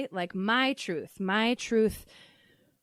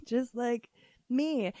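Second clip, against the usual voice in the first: ordinary talk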